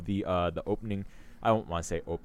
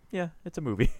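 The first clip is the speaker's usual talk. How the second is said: higher, mock-deadpan shrug of a line; unimpressed shrug; 8 semitones higher, faster than usual. Recalling watching the film once, higher mock-indifferent shrug. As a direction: toss it off like the film left you cold. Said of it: "I'm like".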